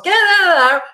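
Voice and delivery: a hammed-up boss yelling at the set; imitating a yell. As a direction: bark it like an angry boss, half in jest. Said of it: "people who yell and scream".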